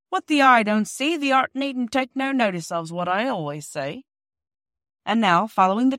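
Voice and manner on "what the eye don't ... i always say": put-on old workman voice.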